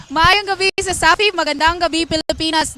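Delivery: bright upbeat courtside greeting; reporter sign-on. Acted. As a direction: open the report with energy.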